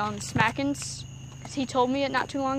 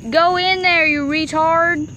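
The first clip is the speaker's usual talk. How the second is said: loud, exasperated shout at the tape; frustrated yell; 4 semitones higher, 8 dB louder, slower than usual. Real, not acted. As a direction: yell at it to get in. Yelling at tape in crack.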